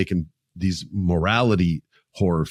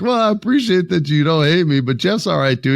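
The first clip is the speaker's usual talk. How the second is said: 1 high amused reply; 9 semitones higher, 3 dB louder than usual. Retold or imitated. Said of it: "I was like".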